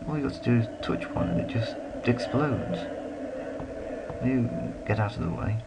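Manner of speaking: ordinary talk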